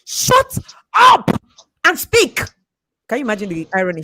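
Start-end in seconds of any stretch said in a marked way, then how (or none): 0.0-2.4 loud, high self-rebuke; mock self-scolding shout; shouting at yourself. Shown as a demonstration. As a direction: yell it at your own reflection. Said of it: "look at yourself in the mirror and say".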